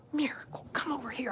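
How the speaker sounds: hushed call to the dog; whispering. Real, not acted. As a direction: whisper it, don't disturb her. Whispering so as not to disturb.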